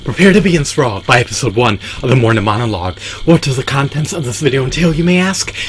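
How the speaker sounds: punched opening, high rising rhetorical question; show announcer. Acted. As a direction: announce it grandly, lift "you may ask" high.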